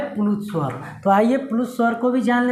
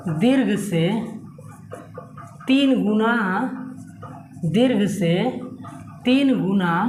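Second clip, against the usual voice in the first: word by word with long pauses; teacher dictating word by word. Acted. Dictating the definition slowly.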